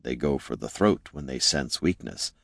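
hushed, low-pitched mutter. A narrator's reading. Warning a companion about orcs, low mutter. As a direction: warn them quietly, under your breath. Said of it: "Jin muttered to Gorm".

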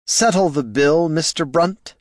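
a touch of sharp impatience; snapped. A narrator's reading. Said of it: "snapped Flynn".

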